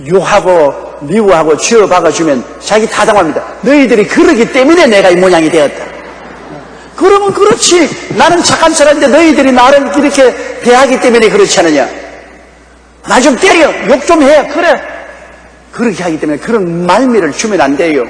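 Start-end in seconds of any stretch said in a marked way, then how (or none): 3.6-14.8 higher, defiant voice daring the family; mock the husband taunting them to hit him; blaming husband. Husband taunting family to scold him, mock defiance.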